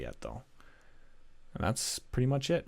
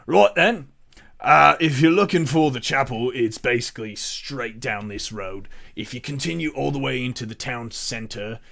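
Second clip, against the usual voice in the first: louder, cheery guard giving directions; helpful guard; 7 dB louder than usual. Acted. Guard pointing the party down the road to the chapel, louder. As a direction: rattle off the directions like a tour guide. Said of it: "He says".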